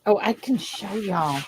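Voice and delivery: usual speaking voice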